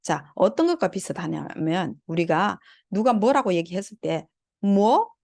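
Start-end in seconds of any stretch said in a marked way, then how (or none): 4.6-5.1 뭐 with the pitch swept upward; rising question. Shown as a demonstration. Teacher imitating someone who didn't catch it, rising 뭐. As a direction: lift 뭐 like asking someone to repeat. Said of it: "하면서 올리잖아요".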